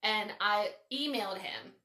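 ordinary talk

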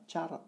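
"Char" ending in an audible R, pitched high; final R sounded. Shown as a demonstration. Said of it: "avec un R à la fin".

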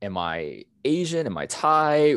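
ordinary talk, nothing marked

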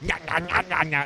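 high singsong nah nah; singsong creature. Retold or imitated. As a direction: sing it like a taunting creature. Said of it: "instead of growling, they just go".

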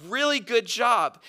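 sarcastic. Acted. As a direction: praise them slowly with heavy sarcasm. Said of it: "he gets a little sarcastic".

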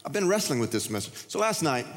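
normal talking voice

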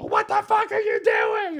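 high, screeched road-rage shout; mock-furious yell, way up high; Sebastian Maniscalco raging out. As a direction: explode at the other driver, comically. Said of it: "like, you rage out".

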